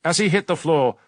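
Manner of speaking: ordinary delivery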